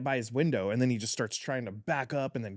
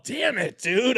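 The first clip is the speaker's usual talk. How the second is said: mock frustration; 10 semitones higher, 4 dB louder, slower than usual. Missed throw at knife guy.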